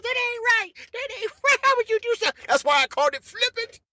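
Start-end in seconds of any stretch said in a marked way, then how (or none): 0.0-2.3 much higher, scandalized voice; mock outraged people. People scolding him for the plan, high and mock-outraged. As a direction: play the indignant critics, high-pitched.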